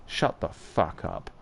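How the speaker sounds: whispered slowly and softly, all menace; threatening whisper. Acted. Woman silencing the man she is interrogating, whispered threat. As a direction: breathe the threat out low and slow. The player character hisses a threat.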